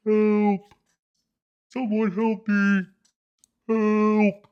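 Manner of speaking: high, far-off squeaky cry for help; high squeaky plea from the hole; tiny mouse cry for help. Acted.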